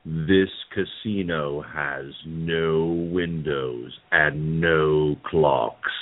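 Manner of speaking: deep, very slow haunted-house host; Paul Frees ghost host. Acted. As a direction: intone it like a spooky ride announcer. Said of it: "I'll use Paul Frees's ghost host voice".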